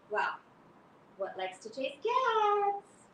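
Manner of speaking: usual speaking voice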